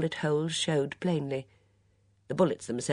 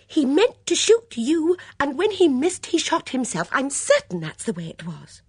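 high, loud, agitated insistence; stubborn; 11 semitones higher, 9 dB louder than usual. A narrator's reading. Dora insists on her theory to her friend, stubbornly. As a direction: dig in loudly, pitched high. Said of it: "repeated Dora stubbornly".

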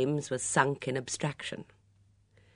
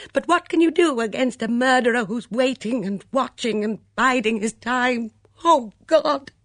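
high, loud panicked outburst; frightened scream; 10 semitones higher, 9 dB louder than usual. A narrator's reading. Letitia breaks down over the murders, screaming in fright. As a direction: cry out at breaking point, in terror. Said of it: "Letitia Blacklock suddenly screamed out".

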